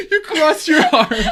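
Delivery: high, loud, slow through laughter; laughing. Real, not acted. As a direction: say it while cracking up. Laughing at sibling's pose.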